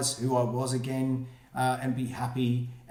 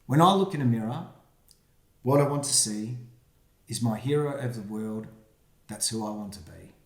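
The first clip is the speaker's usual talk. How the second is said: put-on reading to children; 4 semitones lower than usual. Reads rhyming picture book aloud.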